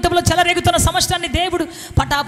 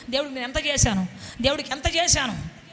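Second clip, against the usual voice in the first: acted grumbling; 8 dB quieter than usual. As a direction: imitate a sulker's quiet complaint. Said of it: "సణుక్కుంటూ, గొణుక్కుంటూ".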